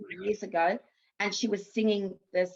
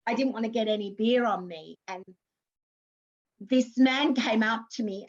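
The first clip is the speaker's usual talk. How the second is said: ordinary talk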